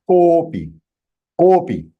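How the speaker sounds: kopi with the "ko" slightly lengthened; one-beat extension. Shown as a demonstration. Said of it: "if it is one time".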